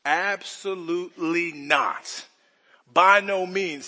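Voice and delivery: slow, drawn-out absolutely not; emphatic denial. Retold or imitated. Pastor voicing Paul's firm refusal, emphatic and slow. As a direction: lean hard on absolutely, stretched and firm. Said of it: "Paul's answer is emphatic".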